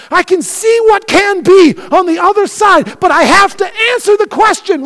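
high, loud, fiery preaching; full-force preaching. Acted. Pastor driving his point home, preaching at full force. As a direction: preach it hard to the church.

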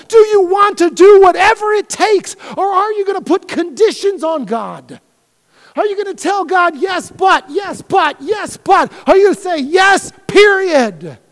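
high, loud, fiery preaching; full-force preaching. Acted. Pastor driving his point home, preaching at full force. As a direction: preach it hard to the church.

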